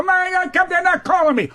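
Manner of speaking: high, whining self-pity; mock the actor begging for calls; whiny mock complaint. Comedian mocking a whiny actor, high whine. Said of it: "Can't go".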